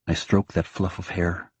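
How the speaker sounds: ordinary delivery, nothing marked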